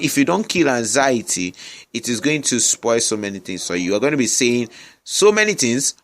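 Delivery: ordinary talk, nothing marked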